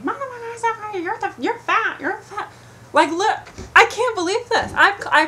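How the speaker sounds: scene: storyteller imitates the woman heckling from the van, high-pitched mock rant; high-pitched jeering imitation of a heckler. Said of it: "she's going like this to me".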